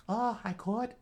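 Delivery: high Southern mother voice; Southern accent. Acted. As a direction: answer in Southern falsetto. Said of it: "pull up my southern".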